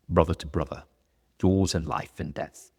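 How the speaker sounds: a loosely put-on Gladiator-style accent, lightly done; half-hearted epic movie accent; bad movie accent. Quoting the Gladiator oath in a bad movie accent. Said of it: "that's a bad accent".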